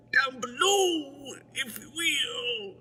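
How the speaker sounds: hammed-up Bill Cosby voice; Bill Cosby impression. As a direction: stretch it out like a famous sitcom dad. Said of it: "my Bill Cosby impression".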